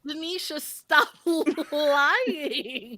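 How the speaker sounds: laughing. Real, not acted. Laughing accusation among friends.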